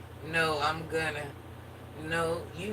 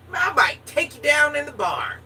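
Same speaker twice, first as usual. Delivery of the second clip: higher and louder, cheeky come-on; loud flirty tease; 8 semitones higher, 6 dB louder than usual. Real, not acted. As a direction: push it out like a bold dare. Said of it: "I'm just gonna be real".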